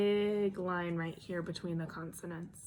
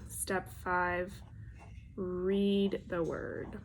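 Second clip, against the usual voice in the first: normal talking voice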